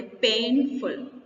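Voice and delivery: Painful slowly, with a long strong PAIN, then a falling "ful"; first-syllable stress. Shown as a demonstration. Teacher modelling it for students, painful slowly stressed on PAIN. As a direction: lean hard on PAIN in painful. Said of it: "तो हम क्या बोलेंगे?".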